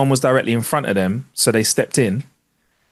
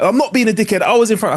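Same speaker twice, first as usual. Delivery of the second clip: whiny other driver; 9 semitones higher than usual. Retold or imitated. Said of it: "he was like".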